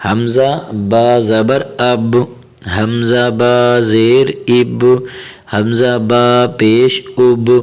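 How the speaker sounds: slow, deliberate Qaida spelling of each vowel; slow spelling recitation. Shown as a demonstration. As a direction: spell it out slowly for the pupils. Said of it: "آگے دیکھیں".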